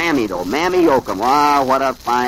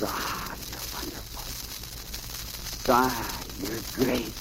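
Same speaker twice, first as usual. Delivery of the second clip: breathed, swooning sweet nothings; hushed adoration; 3 semitones lower, 10 dB quieter, much slower than usual. A narrator's reading. Girl on park bench to boyfriend, hushed and adoring. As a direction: sigh it softly to a sweetheart.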